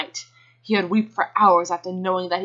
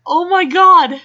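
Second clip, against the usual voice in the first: shocked; 10 semitones higher, 8 dB louder, slower than usual. Real, not acted. Reacting to ending.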